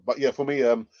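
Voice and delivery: usual speaking voice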